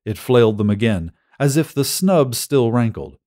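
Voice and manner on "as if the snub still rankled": ordinary delivery, nothing marked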